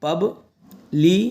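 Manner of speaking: Publication slowly split "pub - li - ke - shan"; syllable by syllable. Shown as a demonstration. Teacher teaching how to read publication, slow separate syllables. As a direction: draw publication out with gaps between syllables. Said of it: "ऐसे तोड़ के पढ़".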